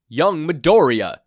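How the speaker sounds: loud shout cutting him off. A narrator's reading. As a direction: bellow his name to stop him. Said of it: "Toshinori shouted".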